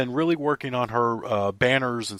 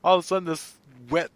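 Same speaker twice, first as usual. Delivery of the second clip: normal talking voice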